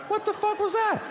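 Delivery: very high falsetto disbelief; bewildered squeal. Acted. Comedian baffled as senator walks off, falsetto disbelief. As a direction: squeak it out in mock shock.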